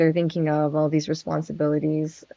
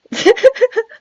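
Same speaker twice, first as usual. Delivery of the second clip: high laugh; 8 dB louder than usual. Real, not acted. Laughs at her own joke.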